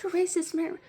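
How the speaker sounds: high-pitched imitation of an aggrieved customer; mock accusation. Hamster customer accusing the store worker, mock-indignant. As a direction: throw it out as a high mocking complaint.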